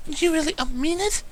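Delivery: very high, timid disbelieving mare; a shy mare stunned by kindness, very high and timid; disbelief. Acted. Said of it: "asked in disbelief".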